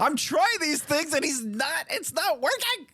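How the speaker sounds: high, laughing whine; laughing mock complaint. Dad laughing while imitating his own frustration, high and giggly. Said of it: "it's like".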